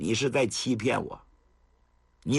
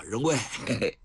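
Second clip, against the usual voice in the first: pleased chuckle; 2 semitones lower than usual. A narrator's reading. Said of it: "程咬金真高兴啊".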